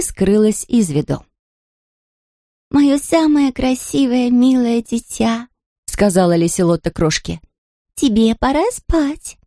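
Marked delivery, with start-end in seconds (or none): 2.7-9.4 tender lullaby voice. Acted. Princess tender to doll.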